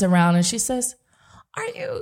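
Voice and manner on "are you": high, soft mock Caribbean accent; bad Caribbean accent. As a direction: put on a poor Caribbean accent, pitched way up. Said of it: "my bad Caribbean accent".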